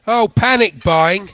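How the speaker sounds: hammed-up shop bloke ribbing him; a stranger in the queue teasing him about panic buying, loud and slow; imitating a teasing stranger.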